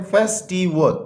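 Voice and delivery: Festival split into three beats, फेस–टि–वल; teacher's careful three-syllable festival. Shown as a demonstration. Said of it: "ये three syllable word है".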